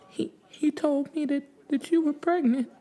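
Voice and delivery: high, halting tearful voice; grieving mother. A narrator's reading. Grieving mother asks widow about the pregnancy, tearfully. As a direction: stammer it out high, through grief. Bereaved mother speaking through tears.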